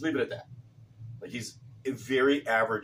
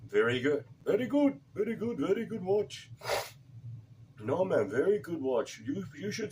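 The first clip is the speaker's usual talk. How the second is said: repairman voice; 7 semitones higher than usual. Retold or imitated. Repairman praising the watch.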